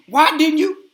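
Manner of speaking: high, loud confronting cry; angry demand. Retold or imitated. Wife confronting her husband when she comes home, loud angry demand.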